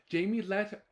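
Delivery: plain reading voice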